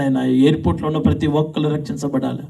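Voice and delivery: usual speaking voice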